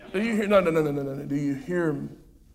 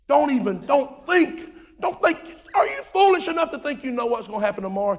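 much higher, fast, urgent warning; rising preaching; 7 semitones higher than usual. Real, not acted. Preacher warning the church not to be foolish, high and urgent. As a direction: climb up high and press them hard.

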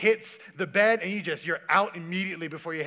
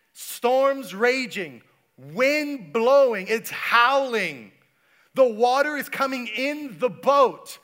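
slow, loud, higher dramatic preaching; preaching the storm; 5 semitones higher, 8 dB louder, much slower than usual. Acted. Pastor building the storm for the congregation, loud and slow. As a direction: paint the storm with big slow words.

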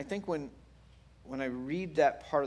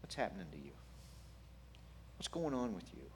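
very soft, tender questioning; hushed and caring; gentle whisper; 7 dB quieter than usual. Acted. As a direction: ask quietly, leaving room to answer. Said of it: "the gentle whisper".

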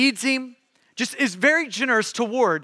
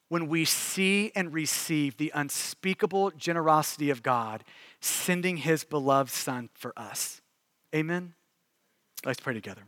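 hushed pastoral appeal; 5 semitones lower, 4 dB quieter than usual. Acted. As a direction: draw the room quietly into prayer. Soft closing and call to prayer.

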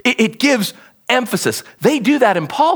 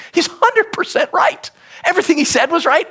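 emphatic rise; 9 semitones higher than usual. Real, not acted. Insisting Jesus was right.

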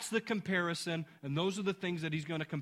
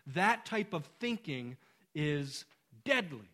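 normal talking voice

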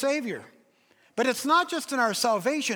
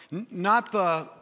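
usual speaking voice

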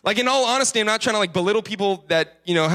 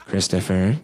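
much lower voice, name stretched as a warning; imitated stern mom; 9 semitones lower, slower than usual. Mom calls her boy about toothbrushing, imitated low warning. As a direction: lightly mimic a mother calling her child out. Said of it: "She calls me Christopher".

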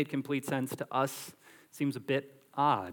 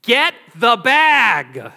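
sudden loud, high, slow shout; panicked yell; 8 semitones higher, 15 dB louder, much slower than usual. Retold or imitated. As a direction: yell it like a wife whose water just broke. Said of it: "and yells to you".

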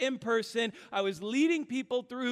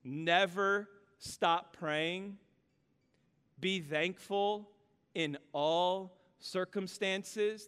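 slow scripture read; 4 semitones lower, much slower than usual. Shown as a demonstration. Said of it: "reading scripture slowly, deliberately".

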